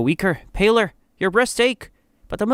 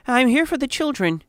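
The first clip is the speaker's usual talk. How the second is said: much higher, timid voice; frightened protest; 10 semitones higher than usual. A narrator's reading. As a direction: protest nervously to a menacing general. Frightened nurse answers the general.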